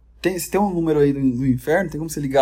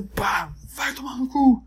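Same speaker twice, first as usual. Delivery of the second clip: mock yell; 9 semitones higher, slower than usual. Acted attack shout.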